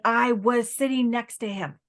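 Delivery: slow, insistent relaying; emphatic spirit message. Acted. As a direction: spell it out as the proud witness. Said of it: "She's like".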